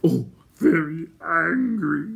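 high, slow, loud creature whine; Gurgi's whine. A narrator's reading. Gurgi reporting on the Horned King, whining. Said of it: "whined Gurgi".

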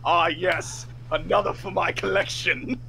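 gloating collector, drawn out and theatrical; Trazen the Infinite. Acted. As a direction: savour it like a smug collector. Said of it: "Trazen the Infinite standing there like".

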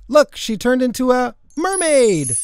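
high, excited discovery; amazed shout. Acted. Boy spots a pet turned mermaid, excited cry. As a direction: point it out in excited wonder. Boy points out sudden transformation.

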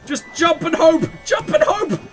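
loud, high rallying yell; a player yells at himself, loud and excited; excited shout. Real, not acted. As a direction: shout it to psych yourself up. Panicked call while platforming.

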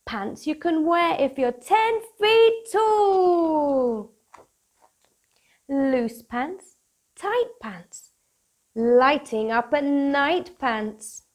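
bright, bouncy storybook read-aloud; lively picture book voice for kids; reading to children. Acted. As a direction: read it like bedtime story time for little ones.

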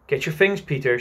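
a touch of panic in the plea; shaky cry to Peter to get ready; crying shakily. Acted. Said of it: "she cried shakingly".

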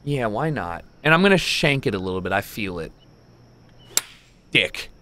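usual speaking voice

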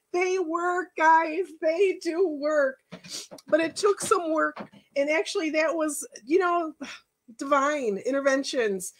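high, softened tearful voice; real emotion lifting the pitch; choked up. Real, not acted. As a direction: keep going while holding back tears. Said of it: "I wasn't gonna cry".

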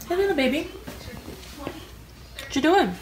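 tender, singsong and stretched out; pet-talk cooing. Real, not acted. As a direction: coo softly to a little animal. Greeting a pet.

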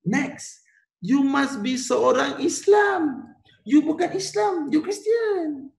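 high, slow, teasing refusal; Sultan Abu Bakar refusing. Acted. Teacher voicing Sultan turning the British away, high and slow. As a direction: talk down to the British like a sly king. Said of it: "Sultan Abu Bakar kata".

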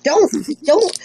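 squeaky chipmunk voice; very high, sped-up cartoon voice; helium voice. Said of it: "You sound like you're on helium".

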